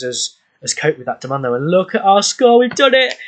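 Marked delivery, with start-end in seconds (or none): 1.5-2.6 excited. Real, not acted. Score milestone reached in game. 2.6-3.1 high, loud, thrilled shout; triumphant cheer. Real, not acted. Celebrating reaching high score.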